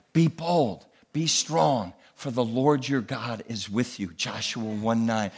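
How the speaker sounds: hushed scripture. Real, not acted.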